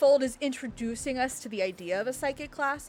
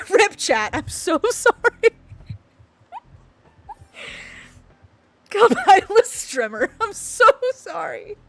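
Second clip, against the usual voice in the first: giggling, high and loud, slowed sorry; sorry through helpless laughter; apologizing to chat while still laughing; laughing apology; 8 semitones higher, 7 dB louder, slower than usual. Real, not acted. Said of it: "You can't trust me with anything".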